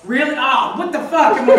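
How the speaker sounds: normal talking voice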